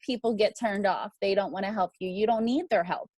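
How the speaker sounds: ordinary talk, nothing marked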